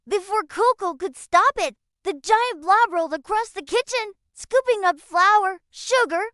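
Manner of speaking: ordinary delivery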